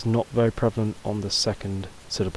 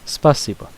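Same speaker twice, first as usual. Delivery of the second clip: "Спасибо" with the first a stressed, louder and higher; 4 semitones higher, 6 dB louder than usual. Shown as a demonstration. Teacher modelling a thank-you word, stress on the а of "Спасибо". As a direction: lean on the "а" in "Спасибо", then fall away. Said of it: "stress mark on the A".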